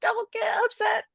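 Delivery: high, laughing mock plea; defensive pleading. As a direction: squeak it out as an anxious salesperson.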